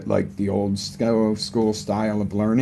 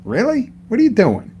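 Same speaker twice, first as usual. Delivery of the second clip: much higher, incredulous wife voice; wife asks what he's doing, much higher and incredulous; imitating wife; 12 semitones higher, 4 dB louder than usual. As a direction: play the wife calling him out in disbelief. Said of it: "And Jen's like".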